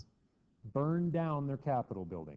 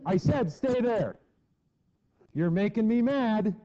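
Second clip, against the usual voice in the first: higher, louder, slow angry repeat; angry parent; 7 semitones higher, 5 dB louder than usual. Retold or imitated. Parent repeating an ignored order, angry. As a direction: let the anger build like a parent losing patience. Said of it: "and you go".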